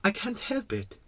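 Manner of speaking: put-on high, soft little-boy voice; embarrassed little prince. Little prince answering the king, quite embarrassed. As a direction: apologize sheepishly in a small voice. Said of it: "quite embarrassed".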